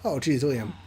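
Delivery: normal talking voice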